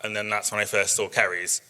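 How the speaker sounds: ordinary delivery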